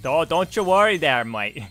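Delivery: loud, hammed-up Canadian accent. Player parodies his own reply, hammed-up Canadian accent. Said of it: "sounds more like a Canadian".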